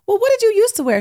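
high, loud mock scolding; excited exclamation. Teasing her friend about old panties, loud excited. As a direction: hype your friend up, half laughing. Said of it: "I said".

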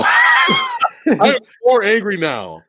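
high, slow, drawn-out angry outburst; exaggerated anger. Real, not acted. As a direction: cry it out, hammed-up fury.

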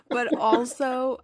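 ordinary talk, nothing marked

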